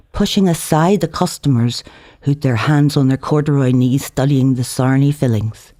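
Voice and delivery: ordinary delivery, nothing marked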